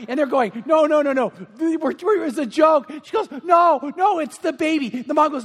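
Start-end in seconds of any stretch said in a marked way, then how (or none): 0.7-2.9 frantic backpedalling. Acted. Family hurriedly reassures crying daughter. 3.4-4.9 high, loud comic wail; hammed-up tearful protest; mock-crying daughter. Daughter horrified by the pregnant bird prank, mock crying. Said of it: "The daughter starts to cry".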